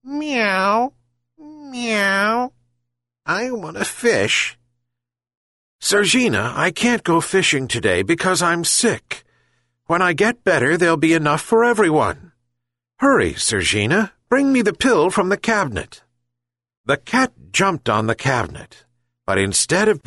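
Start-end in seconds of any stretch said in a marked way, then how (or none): 0.0-4.6 high, drawn-out meowing voice; mewing cat. A narrator's reading. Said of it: "the cat jumped from the roof and mewed". 5.8-16.0 high-pitched urging plea; pleading old man. A narrator's reading. Sick old man urges the cat.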